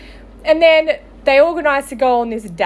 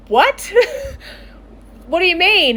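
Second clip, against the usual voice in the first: higher-pitched retold shock; bewildered disbelief; 7 semitones higher than usual. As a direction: act out a baffled friend's reaction. Friend's bewildered reaction, retold.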